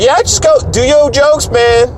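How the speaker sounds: high-pitched imitation of a show host; high mock host voice; Marquis. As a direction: egg the comic on in a put-on high voice. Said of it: "So Marquis was like".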